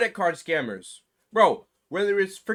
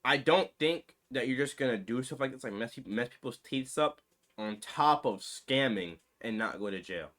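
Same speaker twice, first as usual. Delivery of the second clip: low serious aside about fraud; 7 semitones lower, 8 dB quieter than usual. Real, not acted. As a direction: lower and much softer, dead serious. Grave point about jail, fraud.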